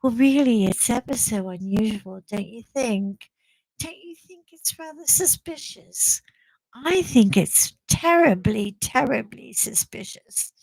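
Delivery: hammed-up genteel English accent; put-on English accent. Vlogger pulling the English thing on the lady, mock English accent. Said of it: "pulling the whole English thing on her".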